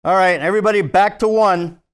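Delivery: loud call across the set. Acted. Director calling the whole crew back to one, loud. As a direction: call everyone back to first positions.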